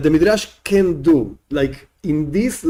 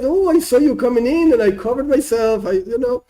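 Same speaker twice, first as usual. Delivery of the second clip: sheepish excuse; 8 semitones higher than usual. Acted. Man making excuses.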